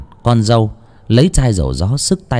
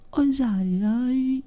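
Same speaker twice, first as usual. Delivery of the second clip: put-on weeping old woman; 7 dB quieter than usual. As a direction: sob it out softly like a grieving grandmother. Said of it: "dấm dứt mà khóc".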